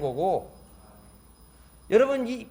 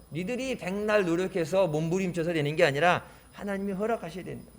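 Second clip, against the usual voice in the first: ordinary talk, nothing marked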